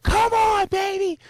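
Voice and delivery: high excited shouting from the crowd; crowd yelling. Retold or imitated. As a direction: cheer the runner toward the finish. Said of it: "people yelling".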